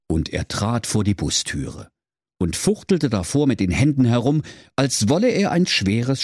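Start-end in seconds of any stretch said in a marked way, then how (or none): none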